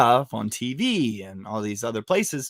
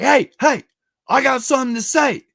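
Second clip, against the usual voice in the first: high, loud attention-grabbing call; retold shout over a crowd; 13 semitones higher, 5 dB louder than usual. Protester-to-be addressing the crowd from dead center, calling out.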